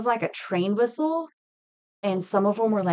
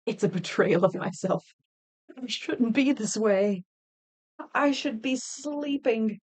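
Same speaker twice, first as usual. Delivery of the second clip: mock despair. Mock lament about waking early.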